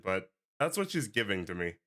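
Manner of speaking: normal talking voice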